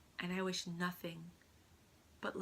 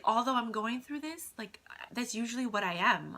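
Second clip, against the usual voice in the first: normal talking voice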